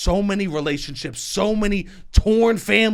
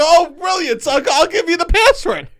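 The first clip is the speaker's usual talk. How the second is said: much higher, louder, hammed-up glee; mock delighted; 10 semitones higher, 6 dB louder than usual. Son giving dad the password, mock delight. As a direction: play it over-the-top thrilled. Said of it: "I go".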